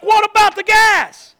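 slow, loud puzzled shout. Retold or imitated. Friend shouting back confused on the late call. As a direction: drag it out, bewildered and loud.

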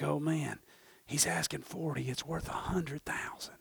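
very soft, low murmur fading to whisper; secret aside. Acted. Appraiser thinks to himself about the price, hushed aside. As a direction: murmur it as a private thought. Acts appraiser's private reaction.

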